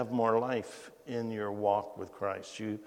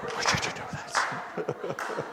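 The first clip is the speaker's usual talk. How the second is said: laughing while speaking; 5 semitones higher, slower than usual. Real, not acted. Laughing at his own story.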